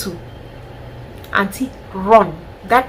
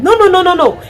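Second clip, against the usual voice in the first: much higher and louder repeated no; emphatic no; 10 semitones higher, 7 dB louder than usual. Real, not acted. Rejecting advice to stay, emphatic loud no. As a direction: refuse it outright.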